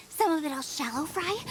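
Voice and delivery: ordinary delivery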